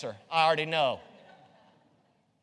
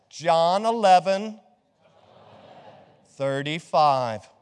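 louder and slow, each part spaced out; slow call to repeat; 2 semitones lower, 6 dB louder, much slower than usual. Shown as a demonstration. Pastor leading the class reciting the verse reference, slow and loud. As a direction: give the reference for them to echo. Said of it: "you repeat after me".

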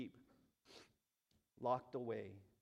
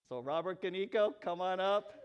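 loud, high stage welcome; calling out; 6 semitones higher, 11 dB louder than usual. Real, not acted. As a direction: call the guest up with raised, rising energy.

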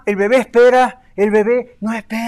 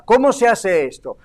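ordinary talk

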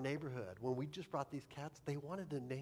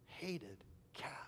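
whispered confession; 7 dB quieter than usual. Real, not acted. Confessing childhood hatred of cats.

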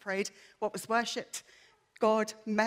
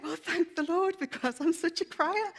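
laughing relief; 8 semitones higher, 4 dB louder than usual. Retold or imitated.

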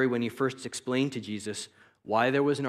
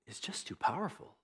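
hushed, breathy aside; whispered warning; dramatic whisper; 3 semitones higher, 9 dB quieter than usual. Acted. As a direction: drop to a whisper as if it's too dreadful to say aloud. Dramatic aside on spirit's power.

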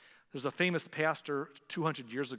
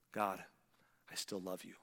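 hushed devotion; 6 semitones lower, 6 dB quieter than usual. Pretend. Believer in a struggle telling God quietly. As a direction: lower and much softer.

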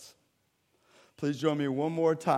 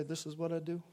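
quiet calm claim; 3 semitones higher, 8 dB quieter than usual. Acted. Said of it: "what Jesus can say".

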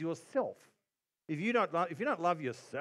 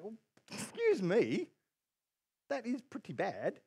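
high, drawn-out indignant objection; slow, swooping mock affront; mock protest; 6 semitones higher, slower than usual. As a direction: object in exaggerated disbelief. Acts own protest at Jesus' words.

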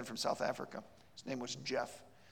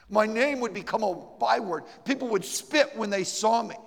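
high, loud rising protest; impassioned refusal; 7 semitones higher, 11 dB louder than usual. Retold or imitated. She lists why she can never believe, high and loud. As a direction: pour out every reason, louder.

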